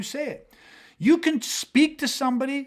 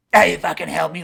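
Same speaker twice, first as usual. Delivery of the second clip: angry retort; 3 semitones lower, 6 dB louder than usual. Retold or imitated. Crackhead snaps back at offer.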